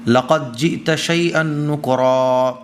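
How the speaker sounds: slow, melodic Arabic recitation; Quran recitation. Acted. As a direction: chant the verse with long held vowels. (دھیمی ترتیل سے تلاوت)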